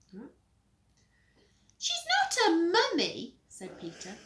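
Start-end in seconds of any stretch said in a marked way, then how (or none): 1.8-3.4 put-on high, loud child voice; whiny little brother. Peter catching Henry in his room, high whine. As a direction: pipe up in a high brat voice.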